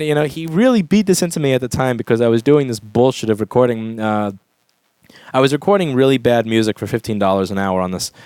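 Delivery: normal talking voice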